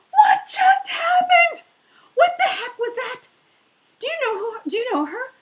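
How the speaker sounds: high, loud, stunned outburst; shocked sister. Retold or imitated. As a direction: sister yelling in disbelief, voice cracking. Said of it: "My sister was like".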